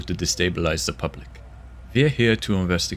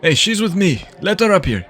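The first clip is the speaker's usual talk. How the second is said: calling out; 9 semitones higher, 5 dB louder than usual. Acted. Calling out across a crowd.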